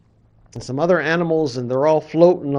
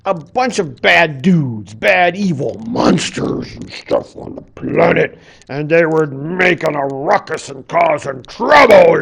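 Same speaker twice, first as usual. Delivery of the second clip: loud, rough storyteller's roar; dramatic growl; 2 semitones higher, 7 dB louder than usual. A narrator's reading. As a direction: raise a ruckus with your voice. Dramatizing the ancient monsters' mayhem.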